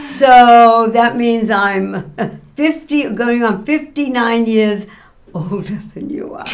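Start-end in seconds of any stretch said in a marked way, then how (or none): 5.3-6.4 breathy secret whisper, voice dropped. Real, not acted. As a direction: whisper it like a joke shared in secret. Joking about her age.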